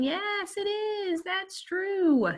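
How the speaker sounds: high-pitched singsong confirmation; playful singsong. Real, not acted. Teacher playfully sings out the answer.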